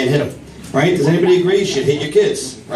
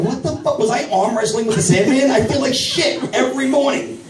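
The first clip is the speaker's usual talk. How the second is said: high-pitched comic bewilderment; exasperated complaint; 11 semitones higher than usual. Acted. Man waking up feeling beaten, high exasperated complaint.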